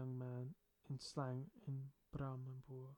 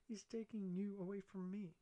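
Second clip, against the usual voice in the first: mock wounded friend; 7 semitones higher than usual. Malati's mock complaint.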